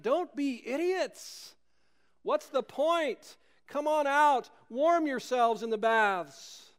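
high, slow mock shout from a shivering guard. A narrator's reading. Guard on the shore shouts to the soldiers, high and slow. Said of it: "the shivering guard shouted".

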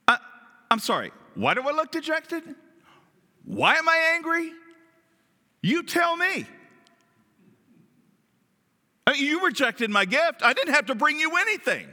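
high-pitched, incredulous protest; indignant. Acted. Preacher voicing what he'd say as Cain to God, indignant. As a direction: answer back, wounded and put out, pitch way up. Acting out Cain's indignant retort.

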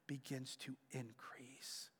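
slow whispered ending; hushed, drawn-out close. Real, not acted. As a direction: let it fade to a slow whisper. Sermon point, hushed.

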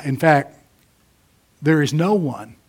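normal talking voice

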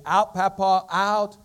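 put-on high, loud little child begging; pleading toddler. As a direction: plead like a tiny boy in a playpen. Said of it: "he begins to plead".

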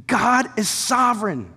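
ordinary talk, nothing marked

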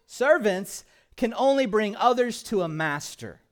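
loud, high, ringing sermon voice; full-force preaching. Acted. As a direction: preach it out with fire.

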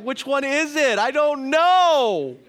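a baffled father asking his daughter which she wants; exasperated dad. Retold or imitated. Bewildered dad retells his reaction.

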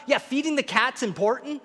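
ordinary talk, nothing marked

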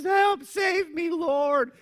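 high, loud, slow desperate shout; sinking Peter shouting to Jesus in panic; panicked cry for help. Retold or imitated. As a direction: cry out like a man sinking in the waves. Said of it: "and he says".

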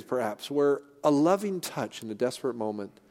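normal talking voice

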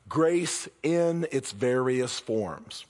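very slow, deliberate restatement; slow repeat. Acted. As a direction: let each word land. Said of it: "the words I really wanna focus on".

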